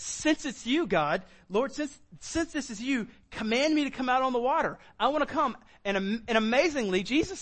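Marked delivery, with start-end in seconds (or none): 0.0-5.6 high-pitched, keen appeal; confident eager request. Acted. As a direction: ask eagerly, sure of who it is. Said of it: "but it's".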